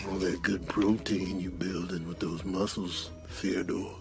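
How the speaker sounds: low, hushed, slow purr; creepy seduction. Acted. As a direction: savour each phrase with hungry longing. Seductive aside to Theodore.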